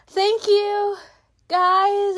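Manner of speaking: high, loud imitation of thrilled gratitude; gushing thanks. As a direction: act out an overjoyed thank-you. Acts out her grateful reply.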